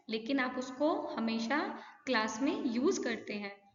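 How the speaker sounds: ordinary delivery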